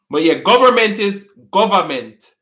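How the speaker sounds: normal talking voice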